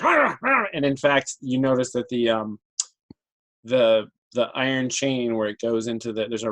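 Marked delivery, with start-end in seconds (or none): 0.0-0.7 high growling wolf sounds. Acted. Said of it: "the wolf is just like".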